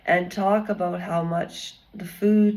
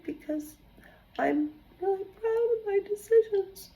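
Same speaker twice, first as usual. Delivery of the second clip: high, soft mock sob; hammed-up teary voice, higher and softer; mock crying; 8 semitones higher, 7 dB quieter than usual. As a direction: imitate a tearful woman, voice cracking up high. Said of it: "she was crying".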